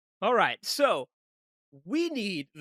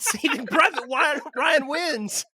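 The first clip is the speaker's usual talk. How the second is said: high, loud imitation of a heckler; hammed-up excited shout; mock yelling out; 11 semitones higher, 5 dB louder, slower than usual. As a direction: blurt it out like a pretend madman spoiling the result. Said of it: "Nick's just yelling out".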